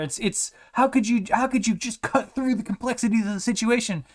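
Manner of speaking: mock awe at his simple wisdom; hammed-up high amazement; amazed committee leader. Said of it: "the disciplinary committee leader is like".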